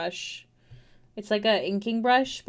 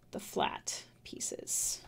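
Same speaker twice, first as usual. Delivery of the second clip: breathy slow whisper; 10 dB quieter, much slower than usual. Real, not acted. Artist whispers to herself while picking brushes. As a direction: murmur "the flat pieces" to yourself.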